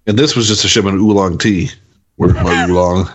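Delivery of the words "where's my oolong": low, demanding alien gangster; deep crime boss voice. Pretend. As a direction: growl it like a slug boss. Voicing Jabba demanding his cargo.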